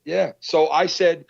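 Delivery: ordinary delivery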